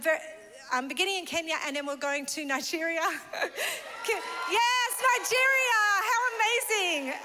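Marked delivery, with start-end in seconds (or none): 4.0-7.1 higher, louder joyful exclamation; excited cheer. Real, not acted. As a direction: burst out with delight. Excited about going to Nigeria.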